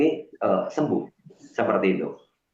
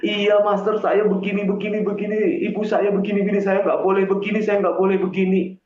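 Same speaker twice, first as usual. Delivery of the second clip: put-on higher-pitched voice of a troubled girl; girl pleading; 4 semitones higher than usual. Girl confiding in the healer about her strict family, higher and pleading. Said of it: "dia ngomong".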